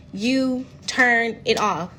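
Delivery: normal talking voice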